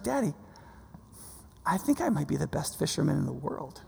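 softly imitated little girl, quietly self-satisfied; daughter's understated boast. Five-year-old telling Daddy about her fishing, quietly boastful. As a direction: lightly voice a child's hushed, matter-of-fact boast.

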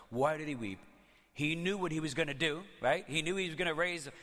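ordinary talk, nothing marked

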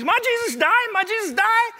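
very high, loud mimicked toddler, rising; shouting child. Son being carried out of church, imitated shouting. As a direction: pipe it up loudly, over and over. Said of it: "he got a little bit louder".

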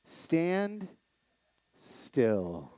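lightly voiced God, words spaced apart; voice of the Lord. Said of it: "like the Lord said".

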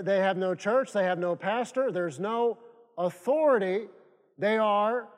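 normal talking voice

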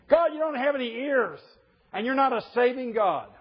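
mock accusation. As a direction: exaggerate the prophet's grievance. Mock paraphrase of complaint.

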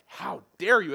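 loud, outraged burst; acted anger. Preacher voicing the ten disciples' anger at James and John, outraged. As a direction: hit it hard, like an affronted disciple. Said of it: "It's anger".

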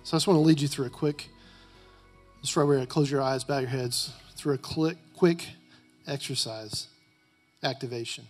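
quiet, low prayer over music; soft prayer. Acted.

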